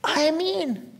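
high, restrained appeal to dad; acted-out politely exasperated son; polite insistence. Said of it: "But I was polite".

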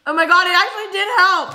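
loud, high burst of amazed relief; real surprise. Real, not acted. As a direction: blurt it out, truly amazed it worked. Chip soothes the burning mouth.

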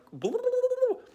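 high, loud rolling babble; silly babble noise. Shown as a demonstration. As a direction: burble wildly up high like a scattered mind. Imitates his scattered ten-second jumping.

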